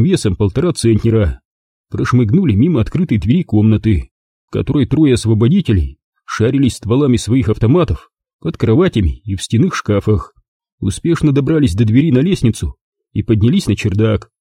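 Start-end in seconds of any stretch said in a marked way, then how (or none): none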